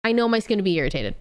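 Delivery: ordinary delivery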